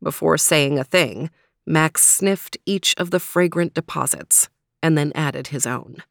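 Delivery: usual speaking voice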